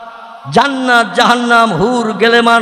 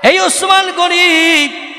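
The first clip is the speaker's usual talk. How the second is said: melodic Arabic recitation; 2 semitones higher, slower than usual. Acted. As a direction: chant the hadith with long vowels. Preacher recites Arabic hadith. (সুর করে হাদিস তিলাওয়াত)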